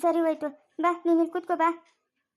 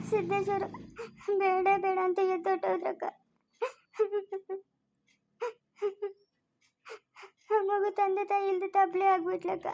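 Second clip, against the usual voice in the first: crying hard, higher-pitched, words broken by sobs; tearful sobbing; 5 semitones higher than usual. Acted. Woman grieving to her elder sister over orphaned Neha, sobbing. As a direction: sob through the words, voice pitched up. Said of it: "ಸಿಂಚು, ಅಳ್ಬೇಡ".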